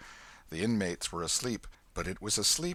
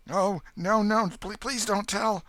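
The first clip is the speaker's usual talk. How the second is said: higher, louder stammering plea; begging; 13 semitones higher, 7 dB louder, slower than usual. A narrator's reading. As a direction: panicky begging boy. Huck begs.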